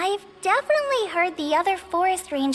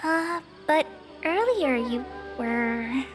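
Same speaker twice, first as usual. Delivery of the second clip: hesitant objection; 5 semitones higher, 3 dB quieter, much slower than usual. Acted. Timid objection, left unfinished.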